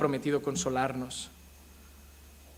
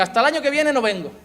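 mock angry mother; 8 semitones higher, 7 dB louder than usual. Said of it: "enfadada y diga".

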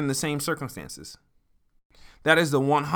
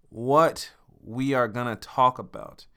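usual speaking voice

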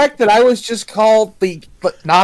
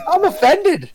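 fake hurt pouting; 8 semitones higher, 3 dB quieter than usual. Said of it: "I'm so offended".

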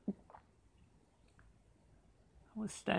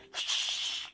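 ordinary talk, nothing marked

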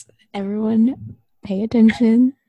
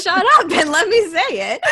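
laughing, higher and louder; 8 semitones higher, 7 dB louder than usual. Real, not acted. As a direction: scold a friend through giggles. Laughing while scolding friend.

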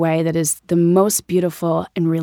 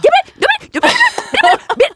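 squeaky, very high, loud yips; starstruck yipping; 4 dB louder, faster than usual. Retold or imitated. Tongue-tied fan meeting her idol at lunch, squeaky yipping. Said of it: "all that came out was this yipping".

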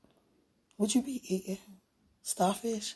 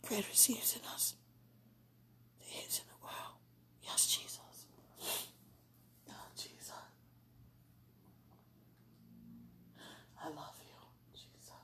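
breathy unvoiced prayer, very soft; whispered prayer; 21 dB quieter than usual. Real, not acted. Woman praying to Jesus alone, in a soft whisper. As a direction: pray under your breath to Jesus.

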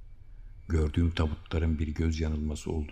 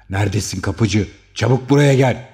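yelling down the stairs for the porter, much higher and louder; frantic shout; 7 semitones higher, 15 dB louder than usual. A narrator's reading. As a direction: bellow for the porter in panic. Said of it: "diye haykırıyordu". (kapıcıya panik içinde bağırarak)

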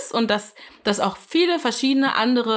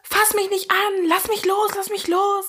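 imitated screaming; 9 semitones higher than usual. Retelling a woman's screams from the street, high mock yell. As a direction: act out a woman fending someone off. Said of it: "wie eine Frau schreit".

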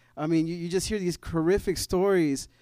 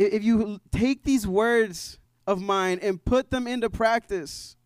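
raised, emphatic pulpit voice, pushing on key words; fervent preaching; 4 semitones higher, 4 dB louder than usual. Acted. Pastor urging his church to trust the foundation, fervent preaching. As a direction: preach it out to the congregation, building conviction.

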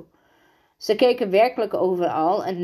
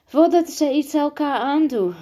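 high, louder breathless alarm; out of breath; 9 semitones higher, 5 dB louder than usual. A narrator's reading. Rosa warning the adjutant about the fight, out of breath. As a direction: cry a warning with little breath left. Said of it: "riep Rosa buiten adem".

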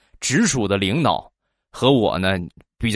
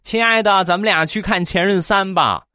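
put-on sweet girlfriend; 8 semitones higher than usual.

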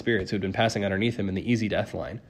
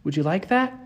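high, wistful question to a dog; faint promise; 8 semitones higher than usual. A narrator's reading. Girl consoling a lonely monster, faintly. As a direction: coax the dog as you part. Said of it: "Annabeth promised faintly".

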